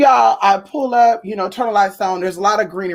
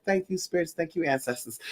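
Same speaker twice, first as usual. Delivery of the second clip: hushed thanks; 11 dB quieter than usual. Retold or imitated. Quoting her own thankful prayer.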